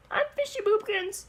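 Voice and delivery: high-pitched cartoon character voice; Fishy Boopkins. Cartoon character introduces himself to strangers, high goofy voice. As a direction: introduce yourself in a silly high voice. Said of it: "you're gonna know Fishy Boopkins".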